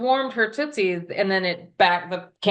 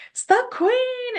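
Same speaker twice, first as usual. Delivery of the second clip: high and drawn out, savoring it; high slow knowing reveal; triumphant reveal. Retold or imitated. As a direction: announce it slowly like a detective naming the culprit. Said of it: "And I'm like".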